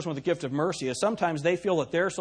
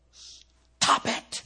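mock scold; 11 semitones higher, 3 dB louder than usual. Reacting to loud teenage girls.

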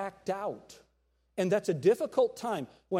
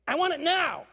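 put-on much higher, louder demanding kid voice; whiny child; 10 semitones higher, 6 dB louder than usual. Preacher imitating a spoiled child demanding it now, high and loud. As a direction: whine it like a spoiled toddler.

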